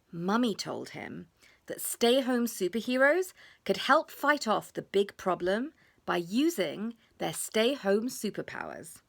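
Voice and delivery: ordinary delivery, nothing marked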